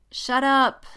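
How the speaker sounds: high, loud, short protest; embarrassed warning. A narrator's reading. Jessica cuts Poe off, flustered. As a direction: cut your friend off, flustered.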